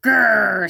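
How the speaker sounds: loud storybook growl; hammed-up growl from a big rhinoceros; rhino growl. A narrator's reading. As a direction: growl it out to scare a porcupine. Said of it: "said the rhinoceros".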